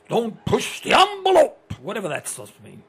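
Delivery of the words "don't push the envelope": loud, high, slow mock order; barked command. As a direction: drag out each word loudly. Acting out schools' commands to kids.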